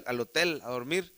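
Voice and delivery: ordinary talk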